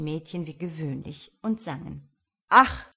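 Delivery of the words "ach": brief, forceful sigh-word; exclaiming to himself. A narrator's reading. Farmer wishes to himself, exclaiming. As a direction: let out one loud, wishful "Ach". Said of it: "rief Mistifax bei sich".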